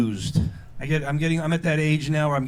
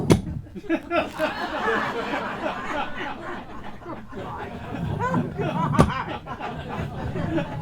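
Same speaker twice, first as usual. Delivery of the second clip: high forced cackle; 11 semitones higher, 4 dB quieter than usual. Acted. Forced laugh after the joke.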